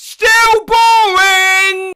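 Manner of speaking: very slow, high drawn-out yell; woman calling out, drawn-out and loud; loud shout. Retold or imitated. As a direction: bellow it across the street. Woman's shouted greeting quoted.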